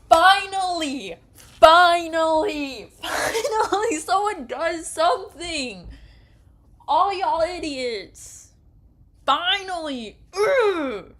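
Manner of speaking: loud, high, slow exultant screaming; triumphant yell. Real, not acted. Player cheering Bash for fighting back, exultant screams. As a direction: scream with relief and joy.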